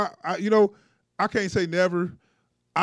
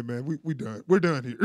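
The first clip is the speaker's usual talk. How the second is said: ordinary talk, nothing marked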